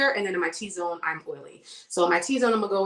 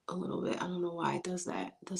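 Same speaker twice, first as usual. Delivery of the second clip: whispering; 12 dB quieter than usual. Real, not acted. Drooping lash, aside.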